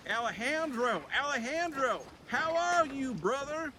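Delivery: high, eager younger bear voice; a cheerful little-brother bear calling out, pitched high; Buzz's voice. Acted. Buzz greets his older brother Alejandro, high and eager.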